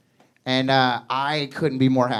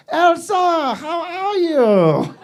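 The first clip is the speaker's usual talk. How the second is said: awkward husband; 12 semitones higher, 3 dB louder, much slower than usual. Retold or imitated. Husband meets wife, high voice.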